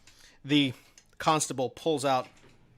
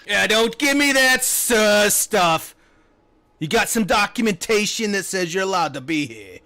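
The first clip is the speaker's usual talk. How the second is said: gruff guard; 5 semitones higher, 7 dB louder than usual. Acted. Guard challenging the party at the gate, loud and hostile. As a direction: lean in and bully them like a swaggering guard.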